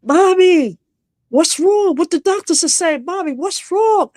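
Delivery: put-on high, pleading child's voice calling for mom; frantic young sons. Sons calling their mother at the hospital, high and pleading.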